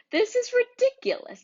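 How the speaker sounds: indignant outburst. Acted. Gretel protesting her chores, outraged and high.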